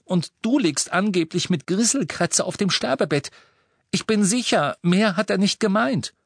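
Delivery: high-pitched, urgent reasoning; pleading Hermine. A narrator's reading. Hermine trying to calm Ron, high pleading voice.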